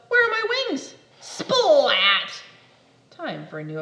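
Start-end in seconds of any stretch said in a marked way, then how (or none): 0.1-0.9 much higher, louder cry; panicked. Acted. 1.2-2.4 loud splat. Acted. Crash sound.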